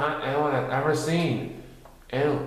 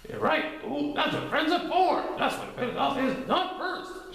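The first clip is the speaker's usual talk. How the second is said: bouncy Tigger. A narrator's reading. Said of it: "shouted Titter".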